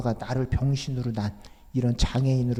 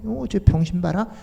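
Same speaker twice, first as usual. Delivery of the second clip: mocking kids; 6 semitones higher, 5 dB louder than usual. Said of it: "계속 옆에 흉내내는 거야".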